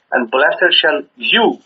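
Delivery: slow, with "you" held apart; deliberate reading that halts on a weighted "you"; stress on you. Shown as a demonstration. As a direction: draw out and lean on "you" as if quoting it. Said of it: "in the quotes".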